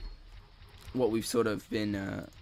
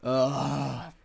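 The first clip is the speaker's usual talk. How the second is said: long, fed-up "ugh"; drawn-out groan of annoyance; exasperated groan. Real, not acted. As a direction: groan it out in frustration. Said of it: "I'm just pissed off".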